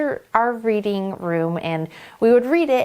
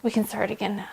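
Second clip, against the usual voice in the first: normal talking voice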